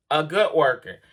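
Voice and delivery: ordinary delivery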